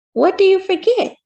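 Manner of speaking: put-on a boy who can't wait for the answer; eager child. A narrator's reading.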